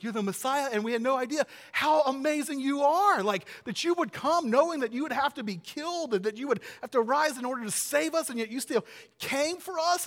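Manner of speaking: loud, high-pitched gushing amazement; gushing disciples. Acted. Disciples imagined marvelling at Jesus' plan, gushing. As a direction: gush in breathless awe, voice pushed high and loud. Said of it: "Well, they think".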